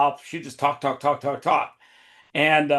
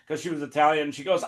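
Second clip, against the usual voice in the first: normal talking voice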